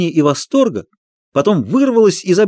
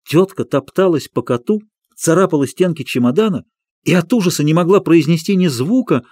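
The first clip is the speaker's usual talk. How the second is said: plain reading voice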